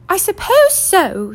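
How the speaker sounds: high, slow, stiff reply; stiffly. Acted. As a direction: answer coldly and stiffly. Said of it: "said Missus Dursley stiffly".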